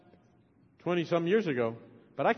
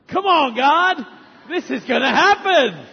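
much higher and louder, bursting with joy; excited cry; 7 semitones higher, 10 dB louder, slower than usual. Retold or imitated. Pastor hoping his parents come to faith, excited shout. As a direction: cheer it out like a sudden hope.

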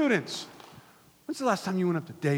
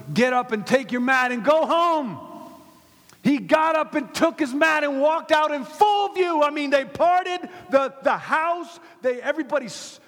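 loud, high, excited Bible storytelling; big, raised preacher's voice; impassioned preaching; 10 semitones higher, 11 dB louder than usual. Real, not acted. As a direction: preach the miracle at full force. Preaching at full force.

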